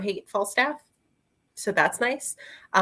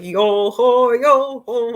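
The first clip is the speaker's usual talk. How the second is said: loud, hammed-up pirate chant; mock pirate; 4 semitones higher, 9 dB louder, slower than usual. Reader mocking the book's pirate dialogue, hammed-up pirate voice. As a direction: belt it out like a cartoon pirate. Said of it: "a pirate's like, for me, not great".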